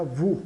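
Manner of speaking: "vous" with a U sound. Shown as a demonstration. Teacher explains OU, "vous" with U sound. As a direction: say "vous" as "vu", S silent. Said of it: "soa U, né?".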